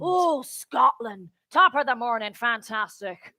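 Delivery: low, slow attempt at Irish; mistaken Irish accent. Performer muddling Scotland with Ireland, stage Irish accent. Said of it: "that's Irish".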